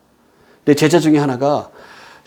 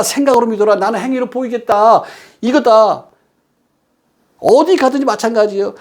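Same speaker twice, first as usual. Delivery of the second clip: high-pitched, insistent preacher voice; emphatic preaching; 7 semitones higher than usual. Real, not acted. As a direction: drive the warning home from the pulpit. Returns to forceful preaching after anecdote.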